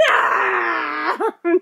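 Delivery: loud, drawn-out mock wail; mock meltdown. Child melting down all over again, loud mock wail. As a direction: wail it like a kid's tantrum, hammed up. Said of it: "meltdown all over again".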